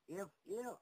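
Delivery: "if" punched high and loud, twice; stress on if. Real, not acted. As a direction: hammer "if", pausing after it. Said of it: "I stress the word if".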